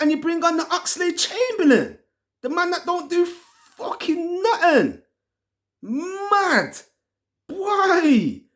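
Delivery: pitched high in disbelief; baffled outrage. Real, not acted. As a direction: ask it like you can't believe it. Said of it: "I am baffled".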